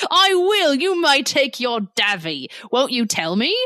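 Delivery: hammy, badly faked British accent; horrible British accent. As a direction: play the indignant servant in a terrible British accent. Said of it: "forgive me for this horrible accent".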